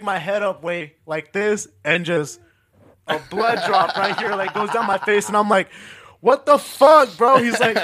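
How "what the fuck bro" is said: loud, higher-pitched indignant outburst; retold outrage. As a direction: protest at the careless barber. Retold reaction to being cut.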